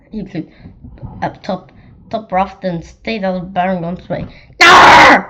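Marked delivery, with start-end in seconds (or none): 4.6-5.2 high, very loud squawk; yelled caw. Acted. Baron yelling back at his crow, squawking yell. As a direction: yell a caw at full volume. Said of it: "yelled Baron von Grump".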